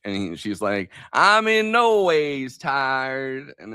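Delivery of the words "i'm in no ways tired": very slow, higher mock Southern preacher accent; Hillary Clinton's fake preacher accent. Mimicking a candidate talking to a church, fake preacher accent. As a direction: stretch every word out in a put-on church-preacher accent. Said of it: "Hillary Clinton was talking to, like, a church".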